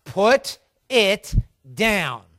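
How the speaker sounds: very slow, word-by-word order; slow and loud. Acted. Father ordering his crying daughter, slow and firm. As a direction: space out each word like a stern father. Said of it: "slow and loud".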